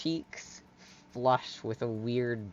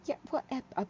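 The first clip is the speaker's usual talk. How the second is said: high, stammering apology; flustered Hyla; 10 semitones higher than usual. Acted. Hyla leaving the guys to it, flustered. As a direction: trip over words while excusing yourself.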